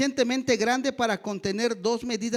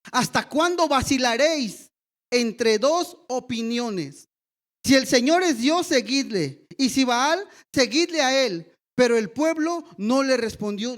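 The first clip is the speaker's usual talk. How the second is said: raised, ringing preacher's voice; preaching at full force; 4 dB louder than usual. Acted. Pastor reads Elijah's challenge to the people, loud and ringing. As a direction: proclaim it to the whole congregation.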